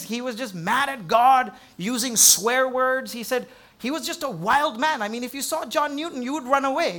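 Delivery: a preacher warming to the tale; building sermon. Acted. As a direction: push the story up with energy. Rising sermon story.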